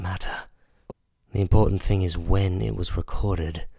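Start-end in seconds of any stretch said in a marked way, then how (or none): none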